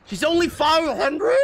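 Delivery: high, loud disbelieving squeak; shocked squeal. Real, not acted. Squealing at the price in shock.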